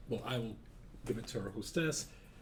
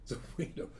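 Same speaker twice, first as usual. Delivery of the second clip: usual speaking voice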